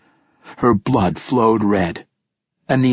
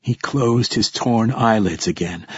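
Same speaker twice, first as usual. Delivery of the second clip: ordinary delivery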